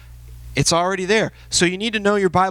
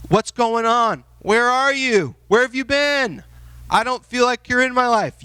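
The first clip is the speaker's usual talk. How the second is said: pleading prayer; 6 semitones higher than usual. Retold or imitated. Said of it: "You need to process things".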